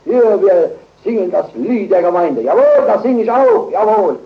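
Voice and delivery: loud, high, eager cry of a zealot; preacher mocks a one-sided believer, loud and high; mock zealous believer. Mock proud believer, loud and high. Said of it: "und sagt".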